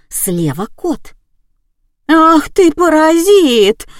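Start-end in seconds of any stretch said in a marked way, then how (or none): 2.1-3.9 loud, high, drawn-out scolding; indignant high-pitched holler; outraged yell. A narrator's reading. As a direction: yell at the useless cat. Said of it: "завопила продавщица".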